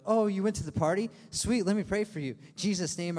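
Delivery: loud, high, pouncing mock prayer; mock zealous reaction. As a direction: pounce on a friend with overdone piety. Acting an overzealous response to partygoer.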